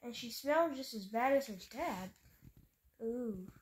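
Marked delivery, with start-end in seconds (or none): none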